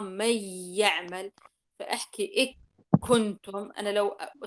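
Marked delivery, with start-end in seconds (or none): 0.0-1.3 "من يعمل" with the nun fully merged into the ya, slowly; merged nun demo. Shown as a demonstration. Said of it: "مو مثل الإدغام". 2.4-3.7 "إك كنتم" with the nun turned into k, loud; wrong merged nun. Shown as a demonstration. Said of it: "سأدغم النون عند الكاف".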